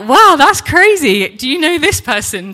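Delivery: loud, high wow of astonishment; amazed. Retold or imitated. Husband discovers a shared connection, loudly amazed. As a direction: exclaim in excited amazement, easing into the question.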